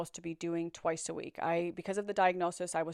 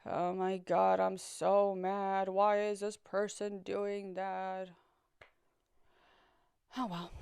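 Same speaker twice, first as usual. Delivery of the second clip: slow, emotionless sadness, lightly acted; numb, detached; slower than usual. Shown as a demonstration. Showing how the pills numbed her sadness, detached and slow. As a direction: let the words go by without feeling. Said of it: "same thing".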